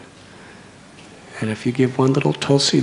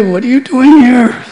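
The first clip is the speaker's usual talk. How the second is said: much higher, louder scornful jeer; mocking ridicule; 11 semitones higher, 9 dB louder than usual. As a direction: sneer at the outsider loudly, as the scholars did. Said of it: "That's the way they".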